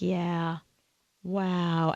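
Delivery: low, soft mock-solemn exchange; hushed and low, with a pause between words; subdued reaction. Guest imitating author and host after sad reading, subdued. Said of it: "they do this wow thing back and forth".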